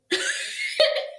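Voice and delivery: short unvoiced high giggle; breathy high laugh. Real, not acted. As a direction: laugh at your own joke. After joke about trading dates.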